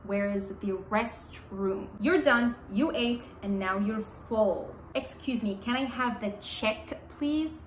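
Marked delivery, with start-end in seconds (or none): none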